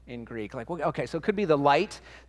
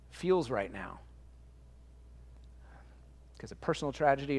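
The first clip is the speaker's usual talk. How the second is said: tender comfort; 7 dB quieter than usual. Real, not acted. Pastor comforting listeners in dark times.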